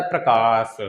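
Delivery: प्रकाश said slowly with a long का; slow prakash. Shown as a demonstration. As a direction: lengthen the का of प्रकाश. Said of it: "प्र और क के बीच में लंबा समय".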